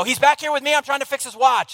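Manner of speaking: very high, loud exasperated holler; acted stressed-out shout; re-enacted yell back. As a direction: yell irritably to the other room. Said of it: "I yell back".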